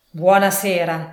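loud, insistent goodbye; curt dismissal. A narrator's reading. Scrooge refuses his nephew's friendship, loudly dismissive. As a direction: shut the talk down loudly.

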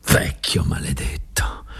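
soft, slow whispered curse; muttered curse. A narrator's reading. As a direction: spit it under your breath. Muttered curse mid speech. (imprecazione sussurrata e lenta)

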